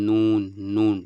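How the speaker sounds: নুন said loud, tongue tip against the palate; Arabic letter nun. Shown as a demonstration. Teacher modelling নুন for students, loud and clear. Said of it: "তাহলে বুঝতে পারা গেল".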